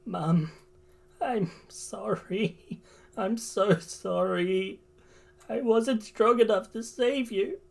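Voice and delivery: put-on high, halting, mournful boy; tearful high-pitched vow; grieving Izuku. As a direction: apologise to a dead mother. Izuku grieves over his mother.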